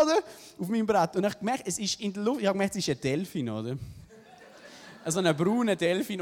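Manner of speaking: ordinary talk, nothing marked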